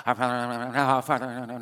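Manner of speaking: mock mindless repetition, trailing into a laugh; rote mumble. Shown as a demonstration. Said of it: "more than repetitive, like".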